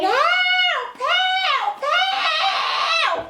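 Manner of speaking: very high calls for help; princess crying for help. Acted. Captured princess calls for rescue, very high. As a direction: cry out like a trapped princess.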